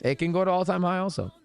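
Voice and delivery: ordinary talk, nothing marked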